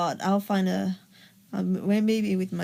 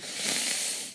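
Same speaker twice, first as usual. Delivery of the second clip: imitated long, controlled exhale; breathing through pain; 8 dB quieter than usual. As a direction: blow out air, holding back tears. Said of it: "just breathing through the pain".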